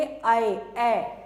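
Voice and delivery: ordinary talk, nothing marked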